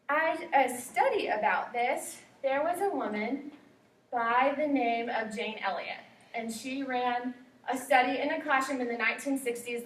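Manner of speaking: normal talking voice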